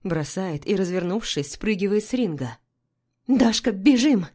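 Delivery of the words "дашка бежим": loud, high urgent shout. A narrator's reading. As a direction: yell at her to run. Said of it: "кричу".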